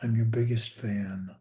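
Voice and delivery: ordinary talk, nothing marked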